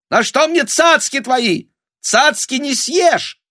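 very high, loud scornful squeal; shrieking peasant woman. A narrator's reading. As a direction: shriek it back in contempt. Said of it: "Какая-то баба взвизгнула". (визгливый бабий крик)

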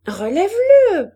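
loud, high, drawn-out order; shouting. A narrator's reading. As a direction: call it out across the garden. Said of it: "cria de nouveau Hélène".

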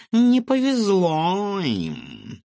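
slow, high gloating purr. A narrator's reading. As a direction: savour the words with malice. Cat gloats slowly.

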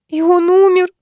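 high, loud anguished cry; shouting. A narrator's reading. Аня кричит в ужасе от мысли, что убила человека. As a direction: cry it out in horror. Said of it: "закричала Аня".

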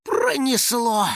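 high, long exhaled word; stretched-out relief; relieved sigh. A narrator's reading. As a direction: let the danger drain out. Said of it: "Финик облегчённо вздохнул".

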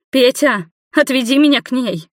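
high, tearful begging; sobbing plea. A narrator's reading. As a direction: plead as if breaking into sobs. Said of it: "опять зарыдала Нина".

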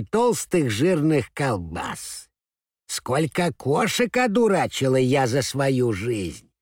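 put-on slow, high, proud old granny mouse; sweet old lady. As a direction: boast slowly like a toothless grandma. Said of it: "прошамкала старушка беззубым ртом".

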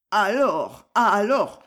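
thick-tongued, stumbling over alors; faked drunken mumble. A narrator's reading. Aubry feigns drunkenness to loosen the writer's tongue, slurring. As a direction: play a man pretending to be drunk. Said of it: "bredouilla Aubry, la langue fictivement empâtée".

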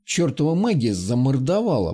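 usual speaking voice

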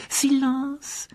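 cri aigu et tranchant; silence coupant. A narrator's reading. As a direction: couper net l'élève. Professeur coupe l'élève. (ordre aigu et sec)

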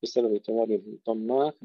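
normal talking voice

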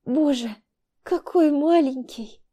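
high, slow happy tears; mother cries with happiness over her newborn; tearful joy. A narrator's reading. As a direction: wonder at the tiny baby through tears. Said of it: "расплакалась Вика".

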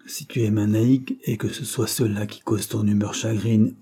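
ordinary delivery

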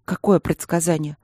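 plain reading voice

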